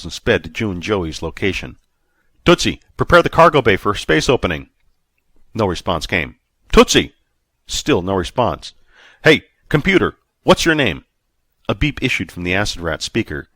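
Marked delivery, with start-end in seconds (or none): 2.4-4.7 loud command. A narrator's reading. Ordering ship computer. 6.7-7.1 loud sharp call. A narrator's reading. Calling unresponsive computer. 9.2-11.0 loud, high impatient question; impatient call. A narrator's reading. Calling computer impatiently.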